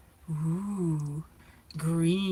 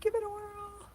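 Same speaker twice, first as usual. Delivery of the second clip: much higher and softer, a tiny squeak; squeaky tiny voice; 14 semitones higher, 10 dB quieter than usual. Pretend. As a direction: squeak it like a little cartoon creature. Repeat in squeaky voice.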